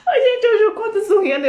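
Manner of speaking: laughing through the words, pitched high; real laughter. Real, not acted. Laughing to viewers about the shower memory, giggling. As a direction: let the giggle carry the line.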